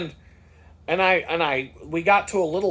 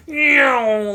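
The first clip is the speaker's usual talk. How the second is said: mock meow; 9 semitones higher, 7 dB louder than usual. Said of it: "he's just like".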